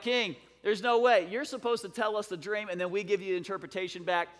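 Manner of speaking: loud, high-pitched mock protest; protesting counselors. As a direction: object to the king in alarm.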